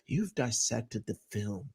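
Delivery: dropping into a hushed know-it-all voice; mock condescension. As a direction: mockingly echo the self-styled expert. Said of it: "condescendingly with this".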